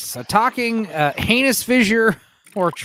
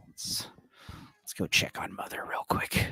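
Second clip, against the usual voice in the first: whisper; 12 dB quieter than usual. Real, not acted. Sneaking off mid-game, muttered aside.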